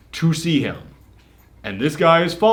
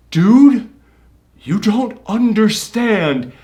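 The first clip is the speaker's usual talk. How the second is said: high, drawn-out, pleading; loud "dude", then slow urgent plea; acted desperate plea; 8 semitones higher, 6 dB louder, much slower than usual. Unmasked villain pleads with protagonist, desperate.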